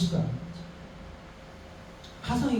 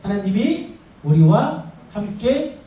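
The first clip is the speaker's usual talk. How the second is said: each word spelled out slowly; slow solemn declaration; slow emphatic; 2 semitones higher, much slower than usual. Real, not acted. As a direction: lay it down word by word. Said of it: "뜻 뭐라고요?".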